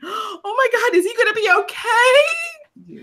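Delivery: hammed-up tearful shriek of concern; mock fan panic. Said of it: "They're like".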